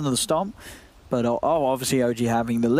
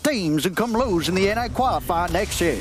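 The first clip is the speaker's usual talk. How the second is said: higher-pitched mock British reply; very British accent; 6 semitones higher than usual. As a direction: quote it in a lilting put-on British accent. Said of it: "I'll say it in a very British accent".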